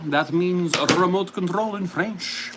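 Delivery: normal talking voice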